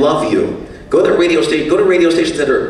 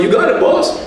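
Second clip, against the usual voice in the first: eager yes-man; 12 semitones higher than usual. Retold or imitated. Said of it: "I'm like".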